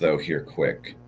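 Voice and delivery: ordinary delivery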